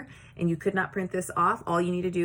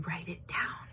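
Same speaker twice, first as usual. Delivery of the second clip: whispered; 9 dB quieter than usual. Real, not acted. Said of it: "teacher instructing".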